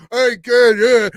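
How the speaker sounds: lightly voiced aging boxer; a hint of a seventy-six-year-old movie boxer; old Rocky impression. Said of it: "he's, like, seventy-six, and he's just like".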